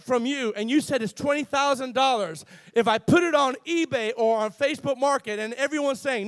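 ordinary talk

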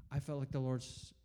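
ordinary talk, nothing marked

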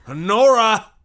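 loud, high call across the house; shouting the daughter's name in alarm. Acted. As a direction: bellow for the missing daughter. Father calls for missing daughter.

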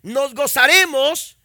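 loud, high, fervent sermon delivery; preaching at full force. Real, not acted. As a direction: preach it to the congregation. (predicación fervorosa, fuerte y aguda)

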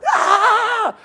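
very high, loud cheering scream; piercingly high mock cheer; sports fan scream. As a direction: let out a wild fan's "aah".

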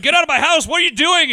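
high, loud mock yell; mock shout. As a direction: bellow at the intruder, hammed-up. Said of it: "this guy's like".

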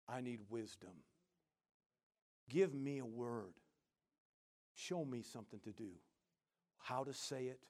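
hushed, earnest prayer to God; a soft, humble plea. Pretend. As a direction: pray it quietly and sincerely. Pastor voices a quiet prayer.

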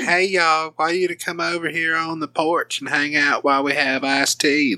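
high, sing-song Southern drawl, lightly hammed; effeminate Southern drawl. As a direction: play a sweet Southern host calling friends to the porch. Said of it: "like, a very effeminate".

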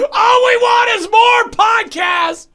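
high, loud, drawn-out wail; hammed-up tearful shriek; mock hysterical scream. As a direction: scream it like a distraught fan. Parodying the tearful fan rant.

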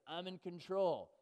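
lightly hammed-up parent proudly declaring control; mock confident parent. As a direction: draw it out like a parent sure they run things. Parent claiming control of toddlers.

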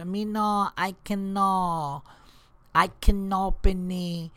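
lightly voiced foreign maid, halting; halting broken English, slow; broken English cleaning lady. Comic answering the stand-up in broken English.

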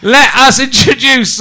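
slow, loud compere call; announcer shout. Real, not acted. Compere calling the room to order, loud and slow. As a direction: bellow the introduction to the room. Compere introducing act to crowd.

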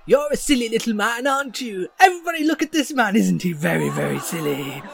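high, sing-song taunt of a silly little man; prancing jester mocking the hero. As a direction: tease him high to the crowd. GM voices jester mocking player.

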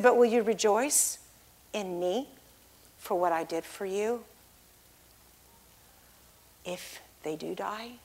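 very soft, low, with long pauses; gentle, grave question. Retold or imitated. God asking the believer to rejoice regardless, hushed and grave. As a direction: drop to a hush and ask it carefully.

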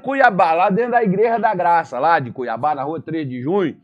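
loud dramatized dialogue. Acted. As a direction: ham up the heavenly exchange. God and Satan dialogue acted. (diálogo encenado, alto e exagerado)

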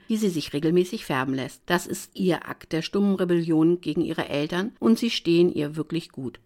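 usual speaking voice

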